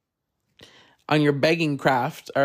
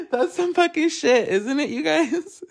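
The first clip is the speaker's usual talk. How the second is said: high laughing exclaim; 10 semitones higher than usual. Real, not acted. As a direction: laugh it out in disbelief. Laughing in disbelief.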